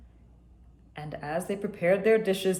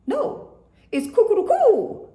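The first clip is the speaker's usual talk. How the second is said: much higher, louder rooster; 14 semitones higher, 7 dB louder than usual. A narrator's reading. Nigerian rooster correcting the others, high squawk.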